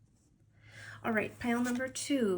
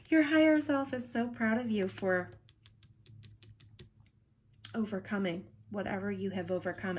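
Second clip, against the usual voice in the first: teary-eyed. Real, not acted. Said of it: "I actually got teary-eyed".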